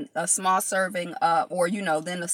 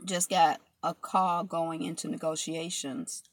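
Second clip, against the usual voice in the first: ordinary talk, nothing marked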